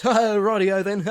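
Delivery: high, loud catchphrase with mock cackle; imitated familiar voice. The bus stranger heard across the grass patch, loud cackling imitation. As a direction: call it high, then cackle long. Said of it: "A familiar voice".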